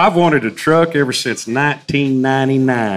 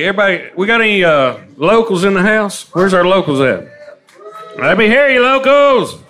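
calling out to the crowd; 6 semitones higher than usual. Real, not acted. Emcee calling the locals to cheer. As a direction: whip up the locals, raising the pitch.

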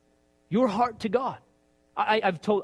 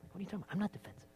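much softer, clipped denial; a muted pretend denial; mock defensive mutter; 16 dB quieter, much faster than usual. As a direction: mutter it under your breath. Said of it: "You like that?".

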